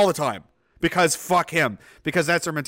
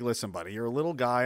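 low, quiet reasoning; 6 semitones lower, 7 dB quieter, faster than usual. Retold or imitated. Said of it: "trying to be like".

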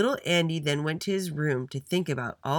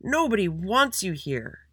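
slow, high indignant yell; 6 semitones higher, 4 dB louder, slower than usual. A narrator's reading. Sammy rejects the recess monitor, yelling. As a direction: yell it at him, stretching the words. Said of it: "yelled Sammy".

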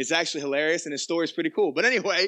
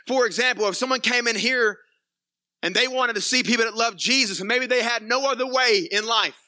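intense preaching; 6 semitones higher, 3 dB louder than usual. Real, not acted. Preacher building on the lost.

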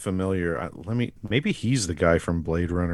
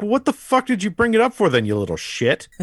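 higher, hammed-up mom snapping at her kid; mock exasperated mom; 11 semitones higher than usual. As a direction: play an irritated mother scolding.

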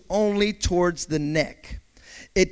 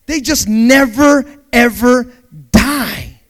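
loud emphasis; 4 semitones higher, 9 dB louder, slower than usual. Acted. Preaching emphasis.

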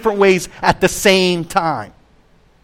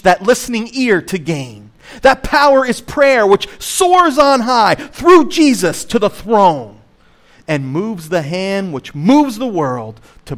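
slow, lifted, dramatic hymn reading; poem recitation; 3 semitones higher, slower than usual. Acted. Pastor reciting a favourite hymn to the congregation, slow and dramatic. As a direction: savour each line like a prized verse.

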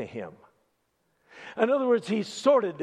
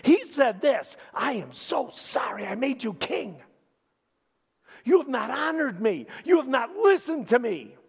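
much higher and louder, urgent cry of stop; shaking, shouting rebuke; 9 semitones higher, 5 dB louder than usual. Retold or imitated. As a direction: prophet trembling as he shouts at the king. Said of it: "he started shaking".

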